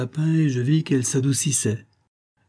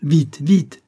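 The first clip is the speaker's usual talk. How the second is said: urgent, louder and higher hurrying; crying out; 5 semitones higher, 6 dB louder than usual. A narrator's reading. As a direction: hurry them along with a loud cry. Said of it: "s'écria-t-il".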